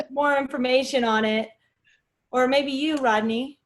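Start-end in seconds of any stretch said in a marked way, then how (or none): none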